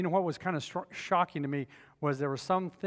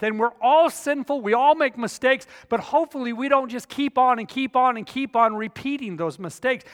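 preaching at full force; 6 semitones higher, 10 dB louder than usual. Acted. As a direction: preach it out to the whole room.

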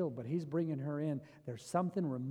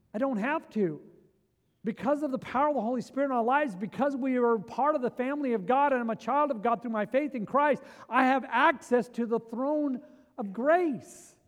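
rising, louder sermon build, pressing each line harder; preaching at full force; 7 semitones higher, 9 dB louder than usual. Acted. Pastor driving home access to God, preaching loud.

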